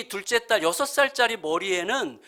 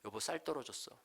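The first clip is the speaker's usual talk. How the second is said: small, hushed, sheepish confession; very apologetic; 4 semitones lower, 17 dB quieter than usual. Retold or imitated. Wife admitting to husband the rice ran out, apologetic. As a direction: imitate a sorry wife, lower and much softer. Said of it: "너무 미안해하면서".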